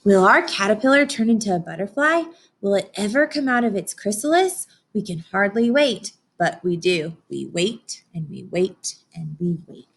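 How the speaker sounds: put-on gentle storybook narration for kids; picture book reading. A narrator's reading. Teacher reading to her class, storybook voice. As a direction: read it like story time on the rug.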